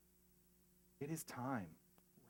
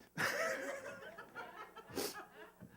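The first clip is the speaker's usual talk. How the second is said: a real, high burst of laughter; 6 dB quieter than usual. Real, not acted. Laughs after admitting he lied.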